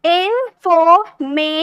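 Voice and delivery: "इन्फॉर्मेशन" slowly in four separate beats; split syllables. Shown as a demonstration. Teacher counting syllables aloud, "इन्फॉर्मेशन" slowly broken in four. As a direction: break "इन्फॉर्मेशन" at every syllable. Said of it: "ये चार syllable हो गए".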